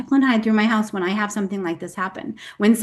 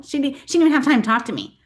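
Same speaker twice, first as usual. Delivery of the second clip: ordinary talk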